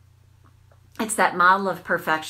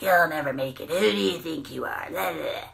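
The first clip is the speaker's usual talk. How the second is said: Hagatha inner critic; 2 semitones lower than usual. Acted. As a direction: sneer it like a mean old witch. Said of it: "I call it Hagatha".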